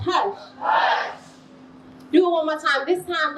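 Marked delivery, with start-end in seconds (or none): 0.1-1.2 crowd hush shout. Shown as a demonstration. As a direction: shout the hush back together. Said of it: "can y'all say hush".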